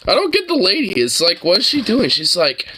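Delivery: ordinary talk, nothing marked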